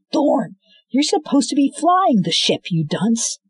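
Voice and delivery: high, exasperated telling-off; scolding Thorn for not flying the ship; scolding shout. Acted. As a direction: berate him for leaving the helm.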